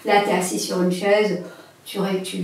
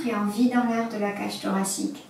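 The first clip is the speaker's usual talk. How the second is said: guided breathing. Acted.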